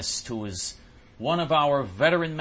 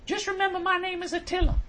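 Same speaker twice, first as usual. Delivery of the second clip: imitating the supervisor. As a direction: parody her as dismissive. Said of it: "so she said".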